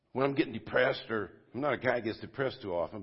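ordinary talk, nothing marked

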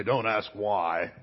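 slow, deliberate parent's rule; stern parent. Retold or imitated. Parent telling a child not to ask, stern and slow. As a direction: lay down the law word by word, slowly. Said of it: "did your parents say".

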